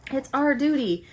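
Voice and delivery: mock a solemn heroine, pitching it up; hammed-up noble resolve; mock-earnest April. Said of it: "she's just like".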